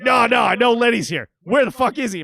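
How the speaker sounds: high, loud shout; stranger shouting. Retold or imitated. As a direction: demand Lenny, yelling. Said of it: "The guy's like".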